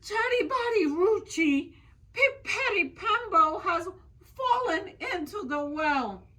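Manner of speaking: high, loud, crying out in alarm; panicked little boy wailing to his mother; frantic cry. Acted. Little Chang cries out to his mother about the well, frantic and high.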